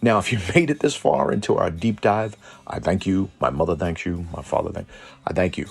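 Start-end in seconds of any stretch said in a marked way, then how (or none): none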